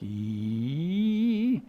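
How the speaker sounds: E sung sliding up a full octave; octave glide. Shown as a demonstration. Demonstrating to students one vowel rising in pitch. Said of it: "I go up an octave".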